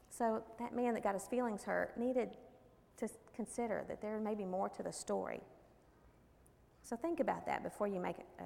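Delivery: ordinary delivery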